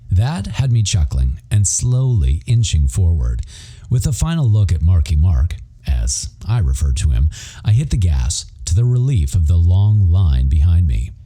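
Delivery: ordinary delivery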